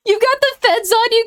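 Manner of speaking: ordinary talk, nothing marked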